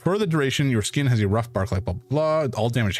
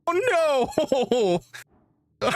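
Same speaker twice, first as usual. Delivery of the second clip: laughing. Real, not acted. Laughing reaction.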